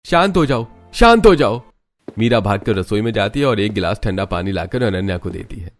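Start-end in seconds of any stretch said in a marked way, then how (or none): 0.0-0.7 high-pitched urgent order; raised-voice husband calming his wife; sharp command. Acted. Husband grabs her hand, tells her stop. 0.9-1.6 high, loud, forceful order; husband's full-voiced exasperated shout; shouted command. Husband repeats his order more forcefully.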